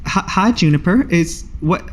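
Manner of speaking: lightly voiced surprised young woman; slightly slowed, mildly surprised hello; surprised greeting. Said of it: "She says".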